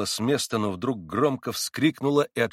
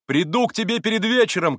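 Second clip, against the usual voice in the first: loud, high parting call; shouted promise; 13 semitones higher, 9 dB louder than usual. A narrator's reading. Alyosha shouts to Mitya as he runs after Katya. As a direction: shout it on the run. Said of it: "крикнул Алёша".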